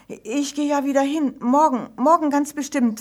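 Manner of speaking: ordinary delivery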